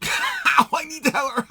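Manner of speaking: high, loud laughing yelp; pained laughter. Real, not acted. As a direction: laugh and cry out at once. Laughing through pain.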